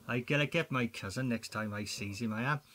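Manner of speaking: usual speaking voice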